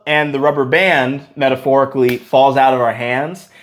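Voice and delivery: ordinary delivery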